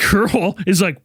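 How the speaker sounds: normal talking voice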